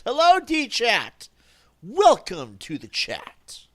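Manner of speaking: huge, high, drawn-out welcome shout; hollered greeting. Pretend. Streamer welcomes the chat, big drawn-out holler. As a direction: bellow it slowly like a hyped-up host.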